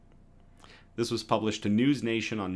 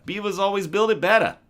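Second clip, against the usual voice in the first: higher, louder, all-in Boston accent; full Boston accent; 10 semitones higher, 8 dB louder than usual. Shown as a demonstration. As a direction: go big on the Boston accent, better as betta. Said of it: "Now say it with a Boston accent".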